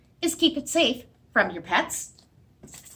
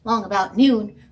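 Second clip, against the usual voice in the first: ordinary delivery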